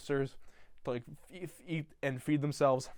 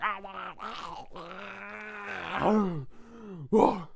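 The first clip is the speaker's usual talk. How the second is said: wolf man howl; 5 semitones higher, much slower than usual. Retold or imitated. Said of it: "pretending to transform into the wolf man".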